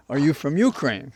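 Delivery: light imitation of a Russian accent. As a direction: voice the woman with a hint of a Russian accent. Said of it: "a woman in a thick Russian accent".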